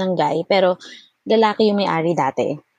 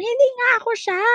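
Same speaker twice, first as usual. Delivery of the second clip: much higher frantic mock yell; retold yelling; faster than usual. Insisting to the caller she is someone else, high mock yell. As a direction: shout it down the phone in exasperation. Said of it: "Nagsisigaw talaga ako sa phone".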